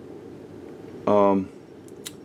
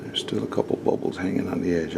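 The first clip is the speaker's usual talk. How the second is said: whisper; 2 semitones lower, 5 dB quieter than usual. Real, not acted. Muttering while fixing bubbles.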